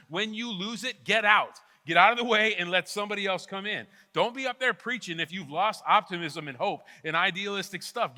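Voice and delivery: loud, driving sermon push; fiery preaching. Acted. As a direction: preach it hard and urgent. Preaching at full force to teachers.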